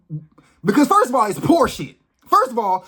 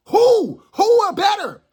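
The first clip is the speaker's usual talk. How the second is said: yelling, voice cracking high on who; furious shout; 11 semitones higher, 5 dB louder, slower than usual. Real, not acted. Ranting at people who treat him as lesser, furious shout. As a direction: shout it at whoever talked down to you. Heated rant, repeated challenge.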